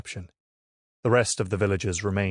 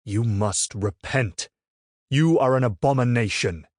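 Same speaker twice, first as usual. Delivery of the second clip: shouting; 3 semitones higher, 4 dB louder than usual. A narrator's reading. Friar shouting at villagers to repent. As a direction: hammer each word at the crowd. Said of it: "Humbert shouted".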